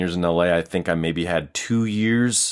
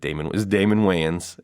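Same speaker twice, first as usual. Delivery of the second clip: ordinary talk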